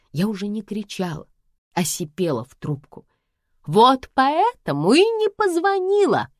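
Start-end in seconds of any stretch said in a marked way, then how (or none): 3.7-6.3 high, loud, slow sulky whine; mother taking offense at her daughter; offended mother. Acted. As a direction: sulk like a hurt child. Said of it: "обиделась мама".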